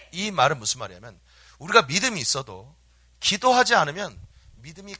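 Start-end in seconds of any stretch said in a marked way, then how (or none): none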